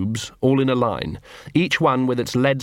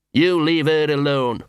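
tag; 3 semitones higher than usual. A narrator's reading. Small dog defends Angua.

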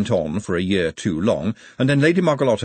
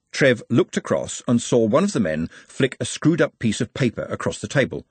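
usual speaking voice

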